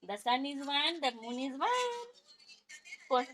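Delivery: high sing-song drilling. Real, not acted. Mother drilling her son in an English line, high and slow.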